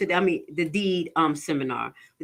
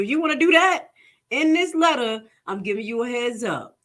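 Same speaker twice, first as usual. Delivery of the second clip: voicing the lender; 6 semitones higher than usual. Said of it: "So they telling you".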